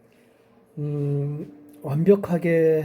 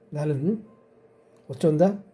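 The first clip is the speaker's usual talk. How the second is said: ordinary talk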